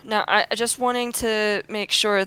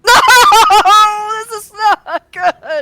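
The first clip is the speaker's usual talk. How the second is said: high laughing wail of despair; real dismay; 13 semitones higher, 9 dB louder, much slower than usual. Real, not acted. Player wailing at the GM over a failed save, laughing despair. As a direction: cry out in laughing horror at the bad roll.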